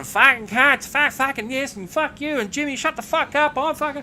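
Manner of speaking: high-pitched imitation of a furious swearing tirade; retold screaming rant. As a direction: act out the colleague losing it. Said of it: "screaming your fucking lungs out".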